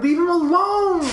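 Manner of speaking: high slow shouted plea; loud high yell. Real, not acted. Yelling at game monster.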